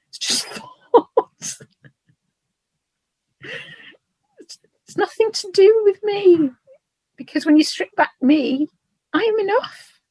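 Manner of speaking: moved close to tears, laughing through it; emotional. Real, not acted. Guest tells the host she is enough, tearful and moved. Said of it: "Sorry, I got a bit emotional then".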